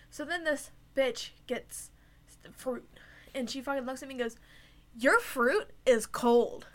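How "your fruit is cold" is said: slow and disdainful. Retold or imitated. Rude customer quoted.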